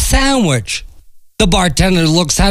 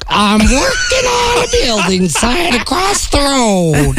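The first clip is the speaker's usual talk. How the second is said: duck voice; 6 semitones higher than usual. Acted. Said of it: "explains the duck".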